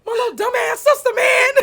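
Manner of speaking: overwhelmed, laughing and crying about her sister; laughing through tears. Real, not acted. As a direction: blurt it out between laughter and sobs. Laughing while crying over sister.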